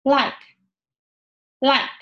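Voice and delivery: "like" begun with a clear L; initial English L. Shown as a demonstration. Teacher showing a word that starts with L, clear L. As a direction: say "like" starting with tongue behind upper teeth. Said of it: "Lで始まりますので".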